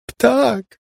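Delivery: very high delighted screech; high gleeful screech. A narrator's reading. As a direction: screech it with glee. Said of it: "skrzeczała uradowana Molly".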